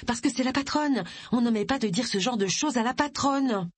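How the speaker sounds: high-pitched, emphatic insistence; insistent explanation. Acted. Insisting on telling the boss.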